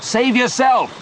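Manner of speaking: high-pitched urgent cry; shout. A narrator's reading. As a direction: shout a desperate order to a friend. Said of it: "shouted Leon".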